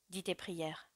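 soft, contained paternal command; grim quiet order. A narrator's reading. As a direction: order the child softly, with finality. Father orders son before killing him. (ordre grave et bas du père)

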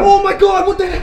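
high, loud shock; panicked cry. Real, not acted. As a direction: cry out in alarm. Alarm at furious character's return.

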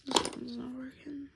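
breathy under-the-breath aside; soft frustrated whisper at a dull pencil; whispered to herself. Real, not acted. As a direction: whisper it to yourself, almost inaudible. Muttering to herself while coloring.

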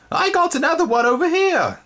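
high, loud insect call across the cavern. A narrator's reading. Spindlebug calling its fellows, high loud insect call. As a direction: shout for the others in a squeaky insect voice. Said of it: "the creature called".

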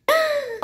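sharp high excited gasp; squeaky surprised gasp. Acted. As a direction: gasp like a thrilled little girl. Doll gasps at news.

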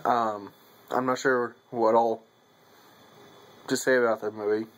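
talking around pipe stem; speaking while smoking pipe; pipe in mouth. Real, not acted. Seen 1: Pipe stem held in mouth corner most frames; briefly removed ~6-7s.

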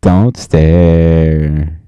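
ordinary talk

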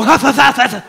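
loud imitated spluttering cough; suppressed cough. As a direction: splutter while trying to hold a cough in. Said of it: "tried not to cough".

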